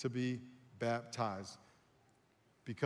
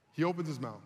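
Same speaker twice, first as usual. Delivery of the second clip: ordinary talk, nothing marked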